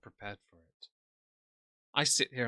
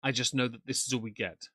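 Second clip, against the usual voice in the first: usual speaking voice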